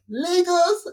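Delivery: high, rising surprised "Lagos?"; mock-surprised mom asking Lagos; puzzled question. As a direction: echo the name back in disbelief. Mother's puzzled quote.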